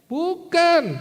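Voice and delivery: high, loud drawn-out "no"; loud, high rejection of the guess; emphatic denial. Real, not acted. Emphatically rejects the wrong answer.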